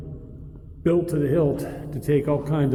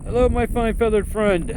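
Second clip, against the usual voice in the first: high, playful greeting to a crow; talking to a bird; 8 semitones higher than usual. Real, not acted. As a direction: sweet-talk the bird, pitched up.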